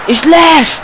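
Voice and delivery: high loud cry; excited shout. Real, not acted. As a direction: yell it out in alarm. Offside call during attack.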